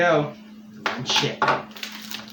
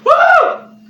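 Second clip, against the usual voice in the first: pained whoop; 10 dB louder than usual. Real, not acted. Burning mouth reaction.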